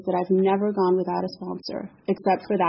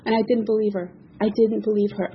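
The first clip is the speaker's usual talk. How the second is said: normal talking voice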